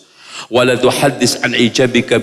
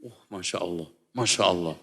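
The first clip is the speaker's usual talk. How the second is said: hushed mock awe, much softer; faked admiration; 7 semitones lower, 14 dB quieter, faster than usual. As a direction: breathe out a put-on, over-impressed Masya Allah. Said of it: "Padahal dibuat-buat".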